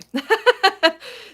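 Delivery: loud, high laugh. Real, not acted. Host to online viewers after saying anyway, laughing loudly.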